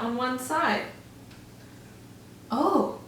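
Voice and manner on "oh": high breathless oh; awed mouse gasping; gasp of wonder. Acted. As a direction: gasp in awe. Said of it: "gasped Livingston".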